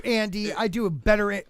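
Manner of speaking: ordinary talk, nothing marked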